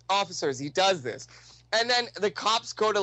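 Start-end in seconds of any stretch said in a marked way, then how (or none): none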